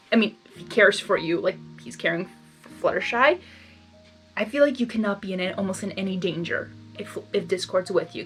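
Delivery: ordinary delivery, nothing marked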